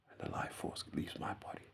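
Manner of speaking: hushed, draining almost to a whisper; life force fading. Acted. Describing their own death to viewers, hushed and fading. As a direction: let the voice drop away softly as life leaves.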